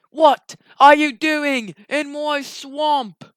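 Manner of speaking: slow, loud indignant ogre roar; Shrek. Acted. Shrek finds an intruder in his swamp, bellowing slowly. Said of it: "And he said".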